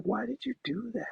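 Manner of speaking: scolding myself. Retold or imitated. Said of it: "I'm like".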